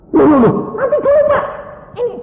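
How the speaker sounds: high, loud, hurried mock panic; flustered nurse. Nurse confused by patient reviving, flustered high voice. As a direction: act out a bewildered nurse. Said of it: "susternya kan kebingungan".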